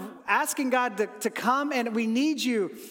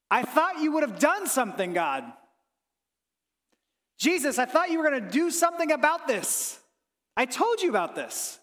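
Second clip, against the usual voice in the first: raised, higher pleading cry at God; anguished complaint; 6 semitones higher than usual. Retold or imitated. Grieving believer crying out to Jesus, raised anguished plea. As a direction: let the hurt push the pitch up.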